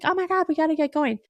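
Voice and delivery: high, fast mock panic; retold rushed urgency. Family late for son's basketball game, high rushed urgency.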